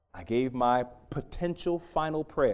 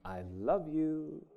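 soft, slow loving murmur; tender whisper; 7 dB quieter, slower than usual. Retold or imitated. Wife at the bedside softly telling her husband, tender and slow. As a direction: breathe it softly, full of love. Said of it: "And the voice said".

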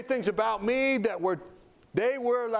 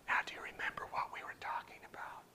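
quick hushed whisper; voiceless, coaxing question; 15 dB quieter, faster than usual. Retold or imitated. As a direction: whisper a gentle reminder. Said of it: "I would like him to whisper to me".

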